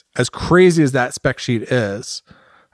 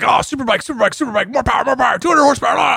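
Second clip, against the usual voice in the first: hyped-up superbike fan; 10 semitones higher, faster than usual. Retold or imitated. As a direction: chant it like a power-hungry fan. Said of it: "We're like".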